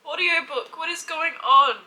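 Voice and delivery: loud, high, baffled cry at the odd music; startled outcry. Real, not acted. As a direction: blurt it out, thrown by the surprise. Confused outburst at the music.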